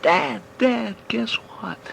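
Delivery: high, loud call to Dad, then a hushed guess what; excited yell. Acted. Boy runs up calling his dad, excited yell. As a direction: call out bursting with news. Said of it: "and he yells".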